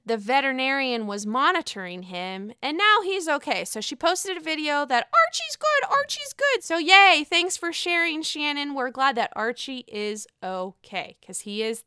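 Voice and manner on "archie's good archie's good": high doting coo. Real, not acted. Happy news about Archie, high cooing.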